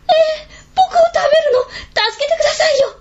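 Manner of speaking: high, crying plea for his life; tearful begging. Acted. Boy begs oni chief not to eat him, crying. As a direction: sob it out, begging not to be eaten. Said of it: "と命乞いをしました".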